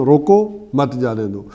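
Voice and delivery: روکو, pause, then مت جانے دو; slow, with a break straight after روکو; full stop after روکو. Shown as a demonstration. Teacher repeating the punctuation example, pausing after روکو. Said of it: "full stop یہ نا".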